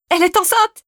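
high, excited shout of discovery; wife realising her friend is pregnant, shrieking high. A narrator's reading. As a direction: blurt it out with a sudden high cry. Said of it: "hurla-t-elle".

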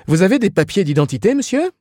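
high, quick policeman's demand; officious policeman. A narrator's reading. Policeman asks Ignatius for his identity papers, officious. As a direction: fire it off high and loud.

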